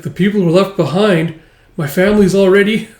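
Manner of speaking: loud, high, breaking off; girl shouting about her dead family; bitter shout. Acted. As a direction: throw his words back at him.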